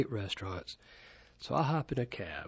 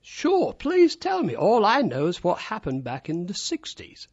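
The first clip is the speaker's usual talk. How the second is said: mock cabbie; 9 semitones higher, 7 dB louder than usual. Retelling the cabbie's reply.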